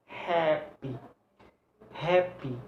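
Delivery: HAPPY with a long, weighted HAP; first-syllable stress. Shown as a demonstration. Teacher showing word stress, HAPPY stressed on HAP. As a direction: lean on HAP in HAPPY, then a short PY. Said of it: "stress. Four syllable".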